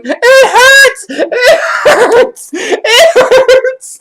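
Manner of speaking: high, loud mock wails between laughs; retold wailing. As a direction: imitate a friend howling from laughing pain. Acting out friend doubled over.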